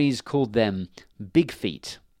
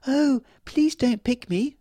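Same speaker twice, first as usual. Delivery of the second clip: high, slow little begging voice; flower fairy begging the man not to pick her; tiny pleading cry; 14 semitones higher, 4 dB louder, slower than usual. Acted. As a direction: plead small and scared. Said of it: "a tiny cry".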